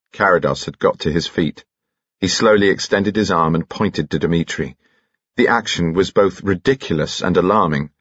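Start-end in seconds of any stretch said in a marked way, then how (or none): none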